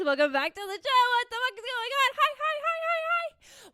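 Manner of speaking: high, squealing burst of welcome; excited greeting. Real, not acted. Podcaster welcoming listeners back to the show, overexcited. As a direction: build to a very high, giddy pitch. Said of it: "sorry, I'm excited. I need to calm down".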